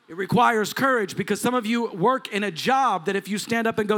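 ordinary delivery

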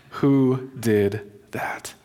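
each word of WHO DID THAT set apart, nearly whispered; slow, hushed question. Retold or imitated. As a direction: ask it very slowly, under the breath. Retold question, repeated very slowly.

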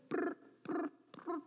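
high, soft trilled chirping; cricket chirps. Retold or imitated. Preacher getting no response, imitated cricket trills. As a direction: imitate crickets in a silent room.

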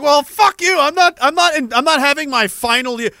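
high, loud comic retort; mock defiance. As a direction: push back indignantly, playing it up.

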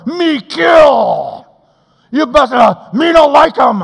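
shouted dumb caveman grunt-speech; angry man as a shouting caveman; caveman talk. Acted. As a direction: bellow like a brute caveman.

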